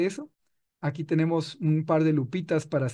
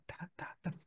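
ta, ta, ta susurrado marcando el paso; susurro rítmico; 21 dB quieter than usual. Shown as a demonstration. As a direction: marca el pulso de una caminata en susurros. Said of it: "Una caminata así". (marca el ritmo de la caminata, susurrando)

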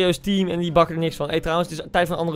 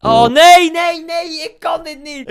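anguished cry; 10 semitones higher, 7 dB louder, much slower than usual. Real, not acted. Missed penalty despair.

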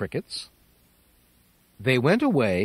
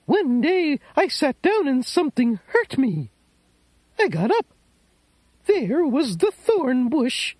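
put-on old lady voice; 12 semitones higher than usual. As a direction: invite him in as a tearful old lady.

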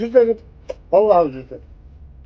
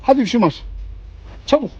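playful cat call; 5 semitones higher than usual. Real, not acted.

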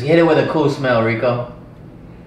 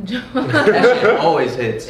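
laughing; 3 semitones higher, much slower than usual. Real, not acted. Laughing about the soda.

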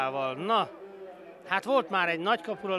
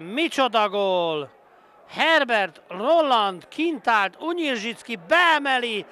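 excited hungarian sports commentary; 5 semitones higher, 6 dB louder than usual. Real, not acted. Goal against, stunned reaction.

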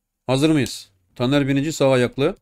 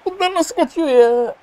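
shocked disbelief; 13 semitones higher than usual. Real, not acted. Gamer shocked at missed chance.

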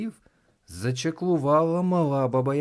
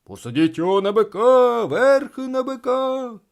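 excited crowd shouting; 12 semitones higher, 10 dB louder than usual. A narrator's reading. Crowd wanting the winner on the bull, loud high shouts. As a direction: shout it out jubilantly.

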